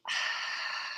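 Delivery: long audible sigh out through the mouth; loud breathy exhale. Shown as a demonstration. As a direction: demonstrate a deep releasing exhale. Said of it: "we're gonna exhale through the mouth".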